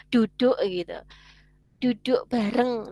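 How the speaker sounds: Javanese accent with heavy D and B. Shown as a demonstration. Teacher illustrates dialect for students, heavy Javanese accent. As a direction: lean hard on D and B like a Javanese speaker. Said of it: "huruf B itu akan terlihat berat banget".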